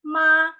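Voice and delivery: "ma" held long on a high level note; "ma" as one steady sung-level tone; first-tone MA. Shown as a demonstration. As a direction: sustain "ma" high and even, no movement. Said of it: "Ton satu".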